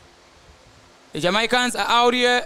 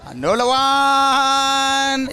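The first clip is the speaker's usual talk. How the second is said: higher, louder, slow stretched shout of the play; excited call; 5 semitones higher, 4 dB louder, much slower than usual. Real, not acted. Commentator calling another US attack, slow excited call. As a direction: draw it out as the attack builds.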